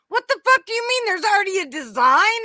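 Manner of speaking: high, loud incredulous outburst; shocked disbelief. Retold or imitated. Retelling the husband's shock at the shirt, loud and high. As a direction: throw it up high as if floored. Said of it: "and he's like".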